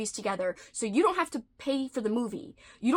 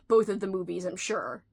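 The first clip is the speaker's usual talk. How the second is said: ordinary delivery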